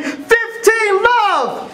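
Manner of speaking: stretched-out, high score call; excited sideline scream. Acted.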